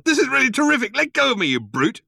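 high, outraged outburst; spluttering. A narrator's reading. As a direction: splutter loudly while being dragged off. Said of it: "spluttered Arthur".

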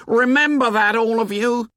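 high, loud shrill warning; shrill it at the whole crowd. A narrator's reading. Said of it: "Piggy's voice shrilled".

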